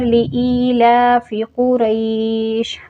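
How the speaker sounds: slow chant, कुरैश held for two counts before the stop. Shown as a demonstration. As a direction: recite with a short two-harakat stretch on कुरैश. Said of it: "मैंने दो हरकत किया". (कुरैश पर दो हरकत खींचकर वक्फ)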